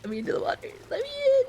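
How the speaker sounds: squeaky high gremlin voice. Pretend. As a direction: whine it like a tiny gremlin. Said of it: "Gremlin noises?".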